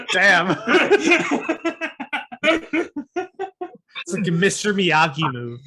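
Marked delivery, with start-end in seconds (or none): 0.6-4.0 squealing laugh, much higher; high delighted laugh; high burst of laughter. Real, not acted. Laugh at Miyagi story.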